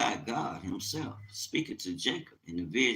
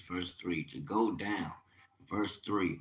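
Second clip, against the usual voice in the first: ordinary talk, nothing marked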